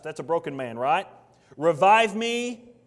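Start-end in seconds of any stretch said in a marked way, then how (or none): 1.6-2.7 loud plea. Acted. Broken man pleading for revival.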